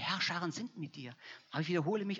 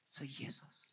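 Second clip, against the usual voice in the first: whispered; 3 semitones lower, 11 dB quieter, slower than usual. Acted. Whispered aside in sermon.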